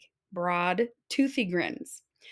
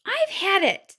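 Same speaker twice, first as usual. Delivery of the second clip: fed up; 10 semitones higher, 4 dB louder than usual. Acted. Bunny fed up.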